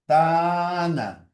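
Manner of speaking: gitana said slowly, syllable by syllable, ga-like stress on TA; drawn out gitana for transcription; slow dictation. Shown as a demonstration. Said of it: "la mayor fuerza de voz".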